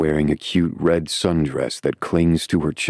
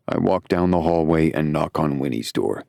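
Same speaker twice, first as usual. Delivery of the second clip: plain reading voice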